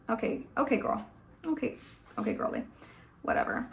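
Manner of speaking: mock dismissive mutter. Vlogger imitating the girl brushing him off, soft mocking mutter. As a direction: toss it away like it means nothing.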